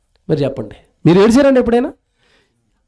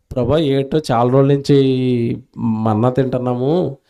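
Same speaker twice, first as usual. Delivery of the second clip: a preacher softly acting a meek asking of the Lord; humble polite request; 9 semitones lower, 4 dB quieter than usual. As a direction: ask meekly, like a grateful servant. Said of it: "అంటే బాగుంటుంది".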